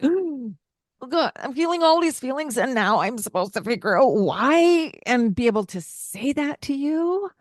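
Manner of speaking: high, fast flustered panic, pitched well up; mock panic. Adult asked why she's crying, in a high, fast panicky rush. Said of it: "a little bit of a panic mode".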